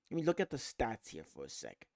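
normal talking voice

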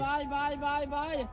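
high, soft sing-song calls; high bird call. Real, not acted. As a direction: coax the hawk down gently. Calling the hawk back.

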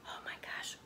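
hushed breathless oh my gosh; awed whisper. Acted. Wowing a young listener, an awed whisper. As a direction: whisper it in amazement. Reacting to picture.

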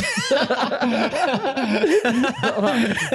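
long, loud belly laugh; hearty laugh. Real, not acted. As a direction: burst out laughing at yourself. Laughing at his own joke.

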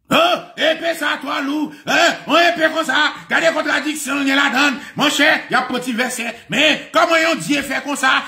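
high-pitched, agitated preacher's rant; heated preaching. Real, not acted. Preaching at full force. (prèch cho, vwa wo)

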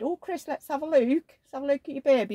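put-on high-pitched doting visitor asking to see the baby; put-on high voice, eager to peek; cooing friend's mum. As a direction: imitate a fussing older woman, pitched right up. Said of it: "You know how they do".

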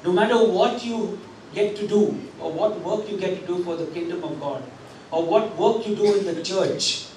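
ordinary talk, nothing marked

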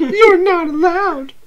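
playful date voice, high and bright; high girly voice. Acted. Abby, high and whiny.

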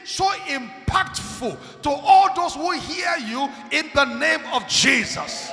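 high, loud shouted blessing; booming pulpit prayer building to the final name; fervent prayer. Real, not acted. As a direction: pray over them at full force. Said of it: "Can I pray for you?".

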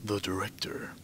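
soft unvoiced whisper. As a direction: breathe the words out. Reading items in whisper.

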